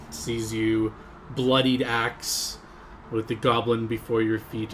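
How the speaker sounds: usual speaking voice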